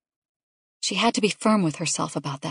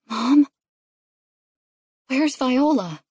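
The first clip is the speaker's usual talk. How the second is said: put-on higher-pitched kid's voice; child calling out a question; 6 semitones higher, 5 dB louder, slower than usual. A narrator's reading. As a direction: ask Mom as a worried little boy. Child asks after missing baby.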